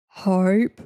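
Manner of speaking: loud "Hype" in her normal low register. Shown as a demonstration. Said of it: "You said it like this".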